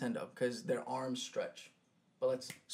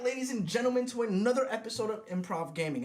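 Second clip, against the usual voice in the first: announcer voice; 9 semitones higher, 5 dB louder than usual. Acted. Podcast host intro.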